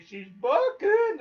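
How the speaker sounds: high, slow, loud panicked wail; panicky cry. Retold or imitated. Nervous hunter on a trotting horse, in a high slow panicky wail. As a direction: drag it out like he's about to be thrown. Scared rider's horse lagging behind.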